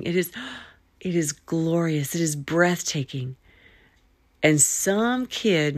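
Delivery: ordinary talk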